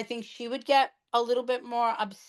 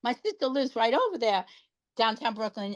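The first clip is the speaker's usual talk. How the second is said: usual speaking voice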